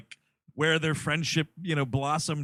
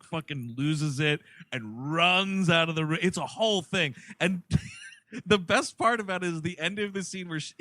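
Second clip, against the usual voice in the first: ordinary talk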